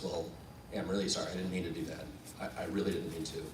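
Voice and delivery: low, soft, sheepish apology; hushed confession. Retold or imitated. Late actor confessing to young castmate, quietly sheepish. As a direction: admit it privately, under your voice.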